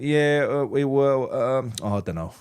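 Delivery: hesitant, trailing-off mock interview answer; imitated uninterested player. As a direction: imitate someone who can't be bothered to answer. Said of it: "never seen anyone look less disinterested".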